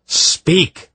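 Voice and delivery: sharp high, loud command. Acted. Polgara orders the intruding mind to answer, commanding. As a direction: order it out in one burst. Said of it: "Polgara commanded".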